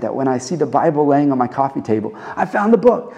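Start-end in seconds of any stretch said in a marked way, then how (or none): 2.4-3.1 high-pitched, thrilled exclamation; excited discovery. Acted. Preacher imagines spotting the Bible on his coffee table, excited. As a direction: exclaim it like a sudden find. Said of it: "give me that kind of excitement".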